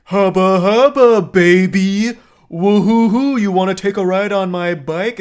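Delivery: loud, hammed-up biker catcall, drawn out at first. Biker dudes to Bella in an alleyway, catcalling. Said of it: "they're catcalling".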